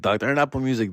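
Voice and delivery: ordinary talk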